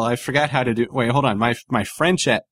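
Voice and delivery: normal talking voice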